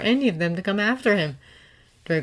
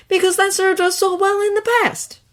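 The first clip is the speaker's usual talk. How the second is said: deadpan; 6 dB louder than usual. A narrator's reading. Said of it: "Draco deadpanned".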